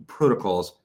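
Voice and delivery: ordinary talk, nothing marked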